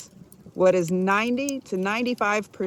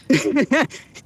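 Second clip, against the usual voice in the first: short, higher-pitched laugh; amused laugh; 6 semitones higher, 4 dB louder than usual. Real, not acted. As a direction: laugh at your own joke. Joking about firstborn's outrage.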